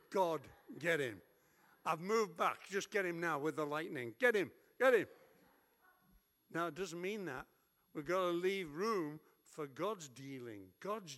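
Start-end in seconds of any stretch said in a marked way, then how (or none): 0.1-5.1 hammed-up plea to God, louder and louder; egging God on to strike him down; mock vengeful prayer. Preacher hamming up a prayer for revenge on Matt, building loud.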